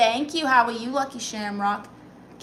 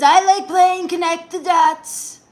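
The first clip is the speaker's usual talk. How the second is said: much higher and louder, slow, put-on voice; put-on Trump voice; 7 semitones higher, 10 dB louder, slower than usual. Reads a chat message from "President Donald Trump" in a hammed-up put-on voice. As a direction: ham up a silly Trump read.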